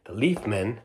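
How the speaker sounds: plain reading voice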